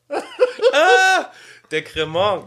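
coughing after swallowing wrong; spluttering from a sip gone wrong; choking on a drink. Real, not acted. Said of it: "ich hab mich tatsächlich verschluckt".

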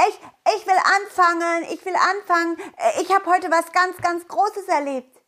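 put-on high, fast, eager kid voice; a child begging to go first.

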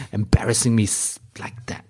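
unvoiced whispered aside. Real, not acted. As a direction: breathe it as an embarrassed aside. (geflüstertes, peinlich berührtes Beiseite)

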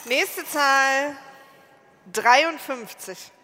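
slow, drawn-out number call; bingo caller. Acted. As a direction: announce each number slowly to the hall.

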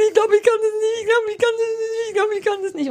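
high, fretful repeated protest; anxious whining. Retold or imitated. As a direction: whine it out nervously, pitched high. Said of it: "ich hab die ganze Zeit gesagt".

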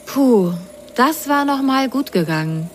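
put-on high, relieved little girl voice; Rosenrot relieved. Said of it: "dachte sie bei sich".

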